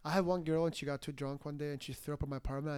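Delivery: usual speaking voice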